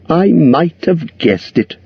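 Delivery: high, slow hobbit voice; butter up the dragon; flattering hobbit. A narrator's reading.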